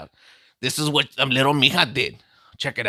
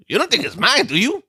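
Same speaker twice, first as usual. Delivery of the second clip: suspicious disbelief; 9 semitones higher than usual. Retold or imitated. Suspicious question to Isabel.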